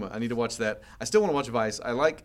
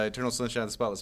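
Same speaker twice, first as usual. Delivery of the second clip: ordinary talk, nothing marked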